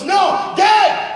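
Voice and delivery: put-on very high, loud child's shout. A narrator's reading. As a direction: shout up to your father. Son shouts up.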